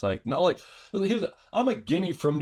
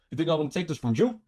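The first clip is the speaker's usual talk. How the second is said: quick mock challenge, "you" punched at the end; stress on "you"; faster than usual. Shown as a demonstration. Coaching a friend in New York menace, stress on "you". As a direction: rattle it off and hit the last "you". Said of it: "didn't emphasize the you".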